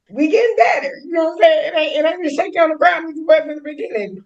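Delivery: high, fast laughing gush; giddy laughing high voice. Real, not acted. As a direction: laugh it out in a rush. Laughing through answer, high voice.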